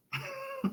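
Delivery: a sudden high squeal of laughter. Real, not acted. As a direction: burst out in a high-pitched laugh. Laughs at straight-faced joke.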